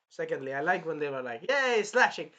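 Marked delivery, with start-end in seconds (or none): 1.5-2.3 high, loud gleeful cry; imitated whoop of delight; mock battle cheer. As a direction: cheer as you swing at monsters. Imitating party gleefully attacking.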